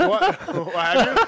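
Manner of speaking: high, loud, laughing disbelief; laughing surprise. Real, not acted. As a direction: burst out in amused surprise. Host bursts out laughing, surprised.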